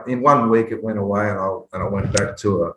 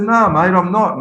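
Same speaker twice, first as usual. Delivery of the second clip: higher, louder mock deflated; lightly voiced flat-out denial; deflated reply; 9 semitones higher, 4 dB louder than usual. Telling the fan he isn't Mark Woodford, mock deflated.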